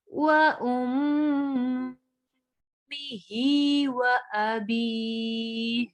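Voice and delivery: slow, melodic tajweed chanting; Quran recitation. Shown as a demonstration. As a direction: draw the vowels out in a slow recitation.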